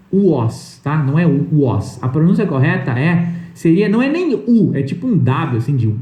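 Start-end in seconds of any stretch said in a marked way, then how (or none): 0.1-0.8 WAS as "u-ÓSS"; wrong pronunciation. Shown as a demonstration. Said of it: "a pronúncia correta não é". 1.5-2.0 WAS as "u-ÓSS"; wrong pronunciation. Shown as a demonstration. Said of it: "a pronúncia correta não é".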